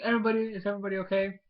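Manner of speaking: high, rushed mock concern; concerned call. Checking on everybody after the explosion, concerned call. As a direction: imitate a hurried check on everyone.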